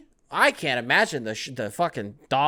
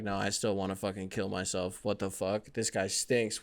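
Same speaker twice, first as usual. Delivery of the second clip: mock despairing Jimmy Butler; 6 semitones lower, 8 dB quieter than usual. Jimmy Butler griping about playing with Ben Simmons, low and mock despairing. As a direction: lower and much softer, mock hopeless. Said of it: "He's gonna be like".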